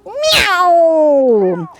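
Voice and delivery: high swooping meow falling away; a meow zooming through the air; long, high firework-cat cry. Shown as a demonstration. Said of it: "Like it's zooming through the air".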